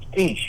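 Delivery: "Speech" with a long ee, quoting his error; quoting a mispronunciation. Retold or imitated. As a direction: repeat the wrong name "Speech" back at him. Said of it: "you keep saying thirty-eight".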